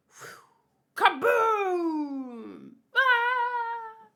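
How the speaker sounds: high loud kaboom then a shriek; mock explosion yell. Kozlo mimics the shelling to scare the kids, yelling. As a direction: act out the blast with a yell.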